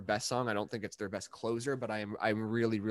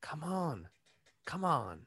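Biden impression attempt; 5 semitones higher, 4 dB quieter, much slower than usual. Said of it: "That's better".

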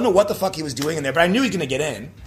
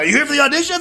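louder, high-pitched imitation of a questioner; abrupt doorway query; 10 semitones higher, 4 dB louder, much faster than usual. Stranger at swung-open door asking a hesitant auditioner, abrupt. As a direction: call it out higher, as a retold interruption. Voicing person who opened the door.

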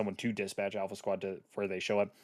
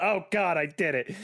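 mock panic; 7 semitones higher than usual. Retelling panic in dark bathroom.

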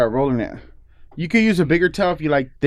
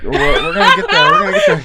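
high giggling laugh-talk, louder; giggly and much higher; 5 dB louder than usual. Real, not acted. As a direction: laugh through the line. Laughing during towel demo.

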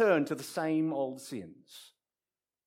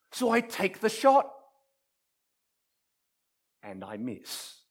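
ordinary talk

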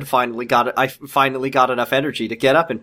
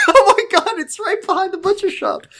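laughing shriek. Real, not acted. Laughing disbelief.

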